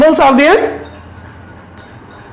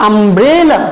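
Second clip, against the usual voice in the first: umbrella with the middle syllable stretched and rising; stress on BRE; 3 semitones lower, slower than usual. Shown as a demonstration. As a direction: lean on "bre" in umbrella, then fall away. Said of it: "এখানে আমরা একটু জোর দিয়ে বলবো".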